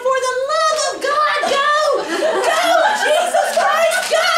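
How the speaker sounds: loud, high, furious honking-driver yell; a frustrated driver screaming at the car ahead to go; angry driver yelling. Retold or imitated. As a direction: bellow it out the car window at the stalled car ahead. Angry driver shouting behind.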